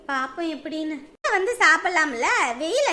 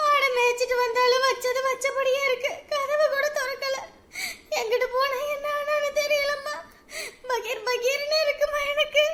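tearful worry; 6 semitones higher than usual. Acted. Worried mother telling neighbour her daughter is missing, tearful. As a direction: fight back tears while explaining.

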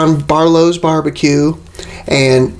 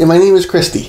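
usual speaking voice

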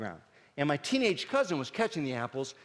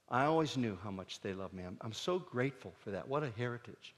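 sincere; 5 semitones lower, 7 dB quieter than usual. Real, not acted. Comedian telling the audience he loves his parents, soft and sincere. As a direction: speak gently from the heart.

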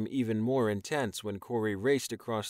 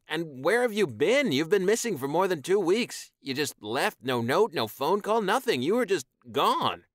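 higher, louder hurt complaint; upset accusation; 7 semitones higher, 5 dB louder than usual. Acted. Corey demanding why Taion left without a note, upset. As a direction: list the grievances in rising hurt.

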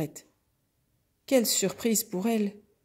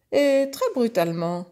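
raised, higher horse voice; indignant horse; 4 semitones higher, 6 dB louder than usual. Acted. Horse piling on complaints to the pig, loud affronted voice. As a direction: add it loudly, much aggrieved.

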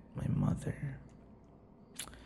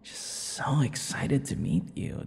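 ordinary talk

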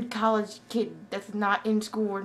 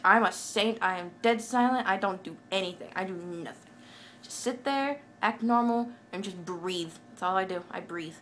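usual speaking voice

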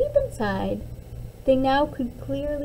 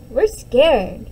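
put-on small scared child's voice, a bit louder; 7 dB louder than usual. Little Jeremy tells Mom the storm scares him. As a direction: admit you're scared, pleading.

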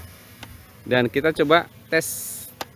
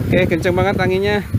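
louder and higher over the wind; shouting over wind; 6 semitones higher, 5 dB louder than usual. Real, not acted. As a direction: raise your voice above gusts. Strong wind during flight.

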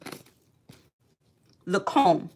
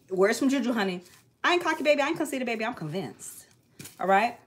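usual speaking voice

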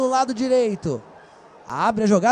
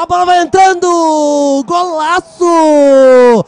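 long held goal scream; explosive goal commentary; 5 semitones higher, 10 dB louder, slower than usual. Real, not acted. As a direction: belt out the goal call.

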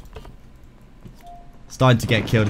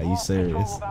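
normal talking voice

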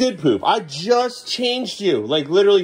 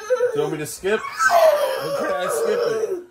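laughing; slower than usual. Real, not acted. Kid's favorite movie scene.

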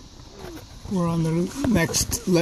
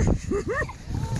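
high creaky cackle; 8 dB quieter than usual. Acted. Master character cackles.

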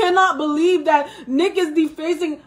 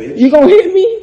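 loud challenging yell; shouting; 10 dB louder than usual. Real, not acted. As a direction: yell it right at him. Confronting during a fight.

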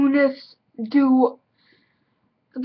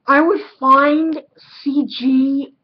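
ordinary talk, nothing marked

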